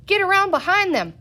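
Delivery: high, loud shout. A narrator's reading. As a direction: call the order across the crowd. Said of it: "somebody shouted".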